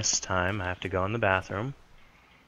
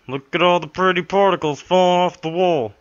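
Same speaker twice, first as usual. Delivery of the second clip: high, sing-song mock wonder; mock delight; 9 semitones higher, 10 dB louder than usual. Player mocking the game's particles, high and sing-song. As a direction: gush over the pretty particles.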